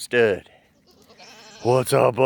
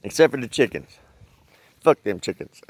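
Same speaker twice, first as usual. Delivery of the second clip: usual speaking voice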